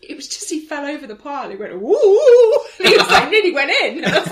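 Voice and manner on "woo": comic falling cry; falling whoop. Retold or imitated. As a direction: whoop as if losing your footing. Imitating the man falling in.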